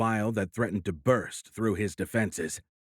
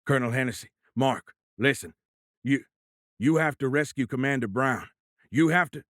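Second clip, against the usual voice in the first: louder, urgent, panting; heavy breaths; 5 semitones higher, 3 dB louder than usual. A narrator's reading. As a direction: push the order out between gasps. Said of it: "Between heavy breaths".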